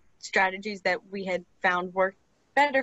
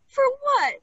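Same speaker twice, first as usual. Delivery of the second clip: much higher, louder puzzled for what; surprised question; 4 dB louder than usual. Retold or imitated. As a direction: pop up in mock surprise. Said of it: "And I was like".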